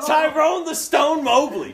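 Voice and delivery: slow, high, loud announcer billing; drawn-out wrestling-style introduction; hyping the guard's nickname; mock announcer. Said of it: "announces his nickname".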